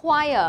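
choir said as "kwire", not "chờ"; say choir right. Shown as a demonstration. Teacher shows pupils how to read the word, careful pronunciation. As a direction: model choir slowly as "kwai-uh". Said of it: "cái này mình đọc là".

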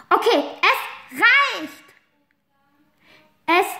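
loud, high, slow "enough!"; confronting the bossy friend; angry shout. Acted. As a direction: slam it down, furious.

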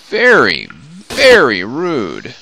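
loud, slow, drawn-out indignation; mock indignant. Real, not acted. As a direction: sound playfully offended. Reacting to ambush in game.

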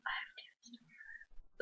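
very soft whisper; retold line, whispered under the breath; whispered reply. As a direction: drop to a hushed whisper.

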